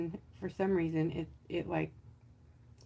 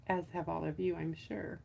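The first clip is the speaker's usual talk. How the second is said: usual speaking voice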